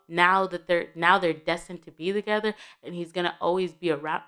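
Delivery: ordinary delivery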